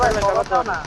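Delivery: urgent loud call for cover; cover me shout. Real, not acted. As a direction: yell for backup under fire. Teammate under fire calling for cover. (Cover চেয়ে চিৎকার)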